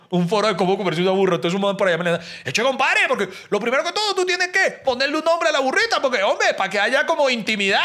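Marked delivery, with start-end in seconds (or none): 2.4-7.8 high, eager voice of a local explaining; chirpy Caribbean Colombian local; coastal local man. Retold or imitated. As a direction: play the helpful village guy. Local man gives advice.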